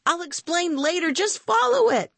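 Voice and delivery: high, hurried-sounding order; urgent call. Acted. Boy to friend as the chase starts, urgent call.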